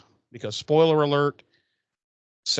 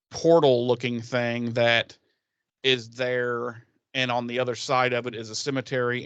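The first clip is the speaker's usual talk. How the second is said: ordinary delivery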